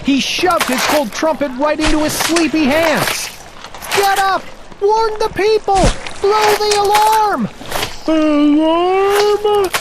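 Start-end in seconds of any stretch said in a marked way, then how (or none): 4.0-7.5 urgent shout. Acted. Waking the snoring trumpeter. 8.1-9.7 sleepy yawned question; yawning. Acted. Trumpeter just woken by Bartholomew, a mild sleepy yawn. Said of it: "yawned the trumpeter".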